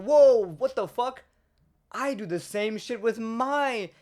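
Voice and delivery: high, loud startled whoa, then a high what the fuck; startled yelp. Retold or imitated. Technician surprised by a fellow user, high and loud startled whoa. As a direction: jump out of your skin, then calm into the aside. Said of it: "they're gonna be like".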